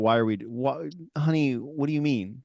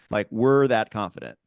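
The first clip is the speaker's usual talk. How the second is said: ordinary talk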